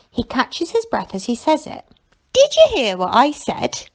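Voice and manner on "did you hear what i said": a little higher and lifted with delight; marvelling. A narrator's reading. Dostoevsky amazed at his own praise of fish, marvelling. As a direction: savour your own words in wonder. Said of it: "he marvels".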